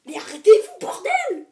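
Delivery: loud shout leaping high at the end; hammed-up exasperated scream; mock outrage. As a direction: yell at them to stop, as a joke. Joking outrage at view count.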